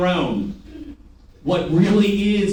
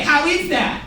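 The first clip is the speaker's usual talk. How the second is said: higher-pitched, smiling question; 8 semitones higher, slower than usual. Shown as a demonstration. Trainer showing sellers what to ask customers, smiling. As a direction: ask it with an audible smile. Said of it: "say it with me and smile".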